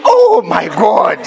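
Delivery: slow, drawn-out amazed "oh my God"; mock-amazed sigh of pleasure; delighted gasp. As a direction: savour it like a treat. Pampered by the massage seat.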